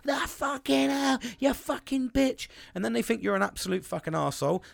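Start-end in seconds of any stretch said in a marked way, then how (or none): 0.1-2.5 higher, slow, snarling mock rage; mock angry outburst. Man imitating himself snapping at his partner, slow and higher. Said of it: "And you're like".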